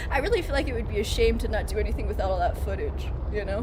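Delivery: ordinary talk, nothing marked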